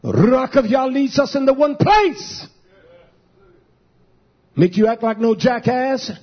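emphatic, scornful pulpit delivery; sarcastic sermon cry; mocking preacher. Real, not acted. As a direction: preach it with open ridicule. Preaches, mocking ecstatic worship.

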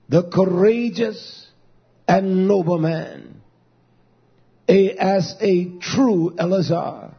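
normal talking voice

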